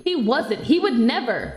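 put-on loud, haughty mean-girl voice; snobby mean friend. Snobby friend brushing off her old friend, loud and haughty. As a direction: look down on her, loudly.

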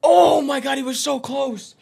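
high, stunned gasp of a near miss; excited outburst. Real, not acted. Commentator reacting to a shot so close, excited outburst.

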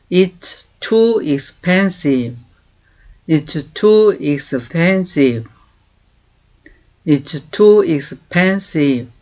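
very slow, clear English for learners to copy; slow model phrase. Shown as a demonstration. Teacher slowly modelling a question. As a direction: stretch each word for repeating. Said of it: "네, 이렇게 말해요".

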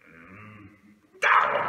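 low hum, then loud deep retort; low, abrupt robber answer; curt robber. Acted. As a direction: dismiss the question bluntly.

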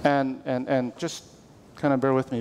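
ordinary delivery

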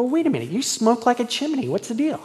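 protesting to chain-smoking coworkers; mock protest. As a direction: throw it back at them, incredulous.

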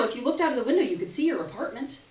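ordinary delivery, nothing marked

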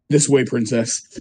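underplay it, slow and low; Toad. Pretend. Armed badass guides the princess, mock-cool and low. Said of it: "and he's like".

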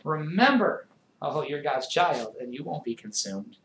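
normal talking voice

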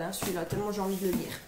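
ironic whisper, fast and soft; whispered aside. Real, not acted. Ironic aside about unwanted book. (chuchoté, ironique et rapide)

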